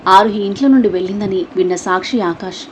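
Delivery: normal talking voice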